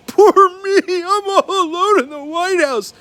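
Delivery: high, loud, drawn-out whine; whiny child mock. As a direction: ham up a self-pitying little boy. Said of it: "And then he writes".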